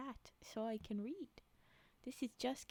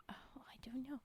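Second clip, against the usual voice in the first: whispering; 8 dB quieter than usual. Real, not acted. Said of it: "Can hear your soothing voice".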